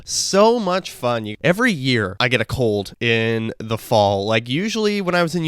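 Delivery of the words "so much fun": sarcastic. Real, not acted. Sarcastic after listing her cold symptoms.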